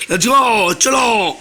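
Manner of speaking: rally the viewers: let's go; excited shout. Real, not acted. Hyping viewers to start magic.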